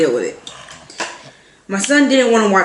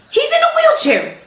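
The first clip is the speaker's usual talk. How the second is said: high disbelieving wail on wheelchair; exasperated squeak. Real, not acted. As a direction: squeal it, can't believe it. Disbelief at the man.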